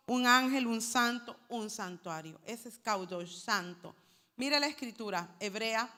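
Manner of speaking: ordinary talk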